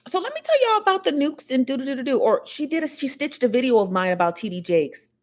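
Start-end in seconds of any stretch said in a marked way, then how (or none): 0.1-2.2 high mimicry of a thick Southern accent. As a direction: imitate her Southern-accented news intro, pitched up. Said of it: "she's got a real thick accent".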